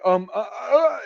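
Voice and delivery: high nervous stammering; flustered stammer. Retold or imitated. Specialist caught off guard by the sergeant, flustered stammer. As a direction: fumble for words in panic. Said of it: "And she's like".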